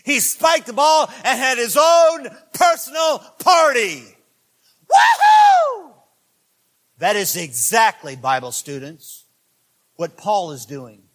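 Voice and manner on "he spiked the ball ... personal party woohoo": loud, high, whooping celebration; excited shout. Retold or imitated. Preacher acting a player's touchdown celebration, shouting Woohoo. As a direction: cheer like the crowd just scored.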